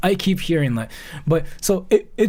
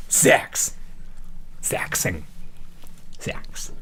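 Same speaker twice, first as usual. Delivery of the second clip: awkward whisper; 10 semitones lower, 5 dB quieter, much slower than usual. Acted. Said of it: "Why do I sound a virgin".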